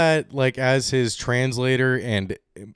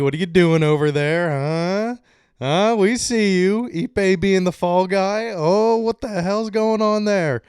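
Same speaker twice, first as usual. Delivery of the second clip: mock taunting call; 8 semitones higher, 4 dB louder than usual. Mock calling out to Ohtani.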